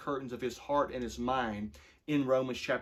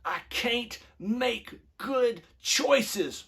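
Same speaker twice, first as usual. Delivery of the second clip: put-on high, slow child's whine; upset five-year-old; 10 semitones higher, 4 dB louder, much slower than usual. Dad retelling his upset son's slow high whine. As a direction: voice the little boy close to tears. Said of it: "he just gets real upset".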